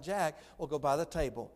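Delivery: usual speaking voice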